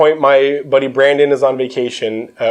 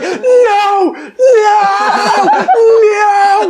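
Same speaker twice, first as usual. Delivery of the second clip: high pained shouting no, much higher and louder; imitated sleep scream; 4 dB louder, much slower than usual. Imitating roommate screaming in his sleep, high shout. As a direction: yell it like a man screaming in a nightmare. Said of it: "like that".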